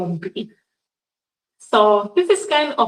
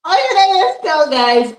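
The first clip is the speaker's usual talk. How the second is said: high and loud, playful; 7 semitones higher, 6 dB louder than usual. Shown as a demonstration. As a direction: call it out brightly. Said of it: "contrast with whisper question".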